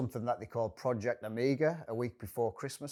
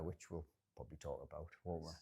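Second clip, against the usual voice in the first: quick aside; 6 semitones lower, 16 dB quieter, faster than usual. Real, not acted. Quiet fast aside.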